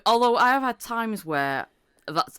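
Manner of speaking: normal talking voice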